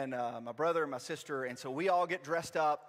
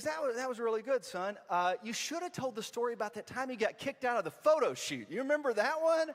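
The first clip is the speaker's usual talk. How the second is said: high, animated mother voice; louder rising question at the end; imitated chirpy mom; 7 semitones higher, 3 dB louder than usual. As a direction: play Mom eagerly reminiscing, pitched up. Mom praises, recalls photo shoot.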